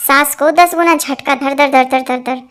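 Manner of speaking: shaky "धर धर धर" mimicking a jolt; mock heart-attack jolt. Joke teller mimics the mother-in-law's jolt, shaky. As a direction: rattle out the shock like a jolt.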